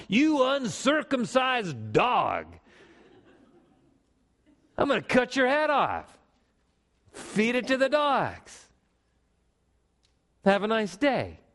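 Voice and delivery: hammed-up taunt at the giant, drawn out; defiant young David. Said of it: "and he called".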